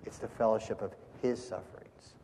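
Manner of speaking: hushed, a beat around "his"; stress on HIS. Shown as a demonstration. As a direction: quietly set "his" apart. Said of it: "is the emphasis on his suffering".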